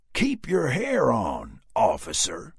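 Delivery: slow, loud Southern sneer; mock the cop with a sneer. A narrator's reading. Dallas to Rick, sneering Southern drawl. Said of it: "returned Dallas with a sneer".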